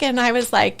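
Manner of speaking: normal talking voice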